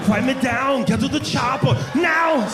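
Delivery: put-on high, shouted Austrian-accent Arnold voice; mock Arnold. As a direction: yell it like Arnold finishing in bed. Climax, acting Arnold.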